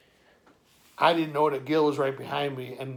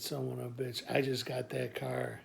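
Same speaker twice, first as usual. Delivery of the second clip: quiet grumble; 8 dB quieter than usual. Retold or imitated. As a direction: swear under your breath. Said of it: "quiet grumble".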